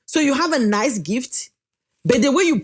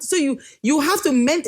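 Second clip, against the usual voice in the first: usual speaking voice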